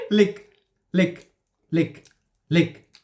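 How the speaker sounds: ordinary talk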